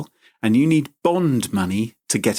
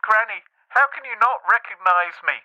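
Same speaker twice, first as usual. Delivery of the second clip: higher, hurt pleading grandson; mock upset; 9 semitones higher than usual. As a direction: act wounded that granny forgot you. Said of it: "he got really upset".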